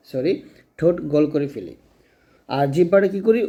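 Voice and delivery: ordinary delivery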